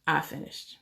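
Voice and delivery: dismissive mock brush-off, murmured; Trump impression. Husband brushes off his wife in bed, soft mock Trump voice. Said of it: "And he might say something like".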